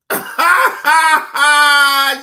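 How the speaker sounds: high, loud belly cackle; loud mocking laugh. Real, not acted. Streamer laughing at the woman being arrested, loud mocking cackle.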